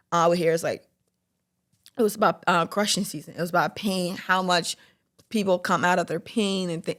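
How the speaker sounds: ordinary talk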